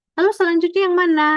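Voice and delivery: ordinary talk, nothing marked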